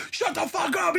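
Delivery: high, strained squeal of disbelief; losing it. Retold or imitated. Two friends reacting to a star's praise, retold freak-out. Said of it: "losing our shit".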